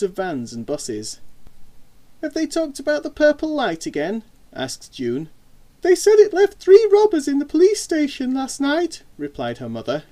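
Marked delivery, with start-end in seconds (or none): 2.2-4.2 put-on high-pitched inquiring girl's voice; young girl. A narrator's reading. Daughter asking mother about the news, inquiring. 5.8-9.0 very high, loud mum voice; mother's voice. A narrator's reading. Mother relaying news to her daughter, high and loud.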